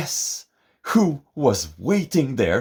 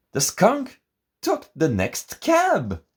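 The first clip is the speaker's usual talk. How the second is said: surprised; 10 semitones higher than usual. A narrator's reading. Dramatic reveal, very high.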